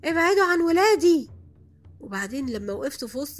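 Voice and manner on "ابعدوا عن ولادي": high, loud protective shout; yelling. Retold or imitated. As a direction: shout it higher and louder, warding them off. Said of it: "قعدت أزعق في الصالة كلها". (صرخة عالية وحادة)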